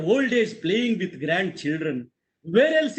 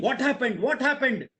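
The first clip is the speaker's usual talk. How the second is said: higher, urgent questioning; alarmed father; 5 semitones higher than usual. Retold or imitated. Father runs in to his yelling son, alarmed. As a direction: repeat it like a worried parent rushing in.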